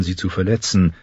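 usual speaking voice

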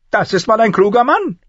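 high-pitched, approving dwarf voice; praising dwarf lord. A narrator's reading. Dwarf praises the knight who caught his coin, high and approving. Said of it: "lobte Lannister".

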